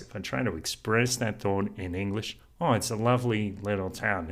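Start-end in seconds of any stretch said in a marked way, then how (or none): none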